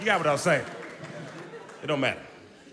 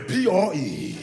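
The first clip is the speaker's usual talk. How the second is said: dumb brother spelling; much slower than usual. Retold or imitated. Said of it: "My brother over there".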